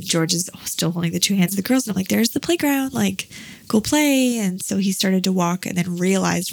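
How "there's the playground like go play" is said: higher, slow, coaxing; encouraging parent. Retold or imitated. Mom nudging George to go play, high and coaxing. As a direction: coax a shy child toward the playground.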